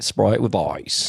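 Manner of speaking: slow, drawn-out Australian "Sprite with ice"; Australian accent, slow and drawn. Retold or imitated. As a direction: stretch the vowels like an Aussie on a plane. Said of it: "It was basically like".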